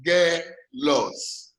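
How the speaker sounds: "Angelos" very slowly, a pause between each syllable; divided into syllables. Shown as a demonstration. Teacher splitting Angelos for the class, slowly syllable by syllable. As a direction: break "Angelos" into three separate beats, An, ge, los. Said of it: "divide Angelos into syllables".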